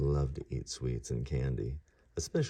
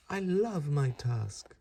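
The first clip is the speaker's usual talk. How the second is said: slow, much higher happy voice; delighted young god; 10 semitones higher, much slower than usual. Acted. Ganesha thrilled with his tusk, slow high happy voice. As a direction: savour it, delighted. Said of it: "said Ganesha".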